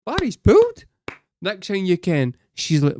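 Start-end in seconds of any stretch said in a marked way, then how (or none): 0.0-0.9 high, loud shouted chant; mock rowdy cheer. Barry's pals shouting across the house party, loud and high. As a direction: yell it like mates at a party. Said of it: "They're like".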